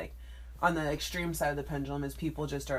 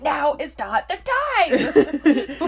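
loud, high, drawn-out exasperated imitation; mock angry; 8 semitones higher, 8 dB louder, much slower than usual. Co-host imitating friend hitting her phone screen, mock angry. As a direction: yell it in hammed-up fury, half laughing. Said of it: "You're, like, angrily hitting the screen".

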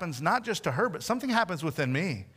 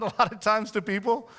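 ordinary talk, nothing marked

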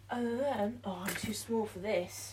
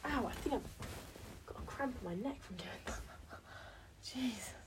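whispering; 9 dB quieter than usual. Real, not acted. Whispering in pain.

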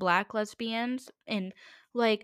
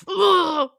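loud high frustrated ugh; exasperated cry; 7 semitones higher, 10 dB louder than usual. Real, not acted. Fed up with the commenter, a loud high ugh. Exasperated outburst after the mock reply.

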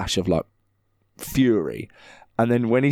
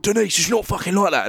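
mock pub quarrel; 5 semitones higher, faster than usual. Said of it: "someone will be like".

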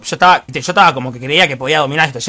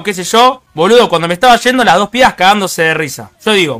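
ordinary talk

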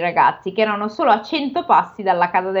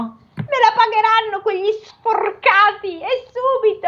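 high, slow ogre threat; furious ogre; 12 semitones higher than usual. Acted. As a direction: vow revenge as the ogre. Ogre vows revenge.

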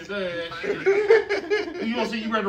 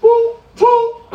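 much higher, louder squeaky boop boop; mock smack noises; 13 semitones higher, 8 dB louder than usual. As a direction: squeal the smacks as cartoon sound effects. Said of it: "where he said, "Meet my".

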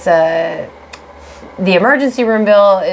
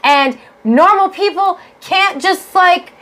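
high, heated rant about the bills; 8 semitones higher than usual. Real, not acted. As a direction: vent it hot and high. Ranting at medical billing.

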